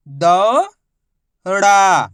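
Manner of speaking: दौड़ा split into दौ, long pause, ड़ा; syllable by syllable. Shown as a demonstration. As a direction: stretch दौ upward, pause, then a falling ड़ा. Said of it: "उच्चारण करके आपस में लाके".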